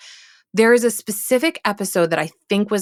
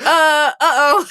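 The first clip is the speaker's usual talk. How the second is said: high, loud, drawn-out "uh-oh"; mock alarm; 9 semitones higher, 5 dB louder, slower than usual. Stumped by co-host's question, mock alarm. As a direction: stall in comic panic, high and slow. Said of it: "I'm like".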